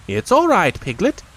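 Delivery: high comforting rabbit; fussing rabbit, reassuring. Acted.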